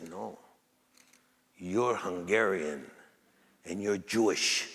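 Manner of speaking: put-on low, soft, slow old man's voice. Old man tells Olmos his roots, low and hushed. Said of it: "and he says".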